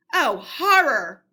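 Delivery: ordinary delivery, nothing marked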